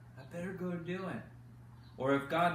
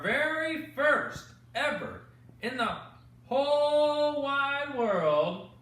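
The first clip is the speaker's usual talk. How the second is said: higher, louder and very slow, stretching whole wide world; grand announcer; 10 semitones higher, 7 dB louder, slower than usual. Acted. Host announcing the first bedtime stories to kids, slow grand showman voice. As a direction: boom it out like a showman opening the big show.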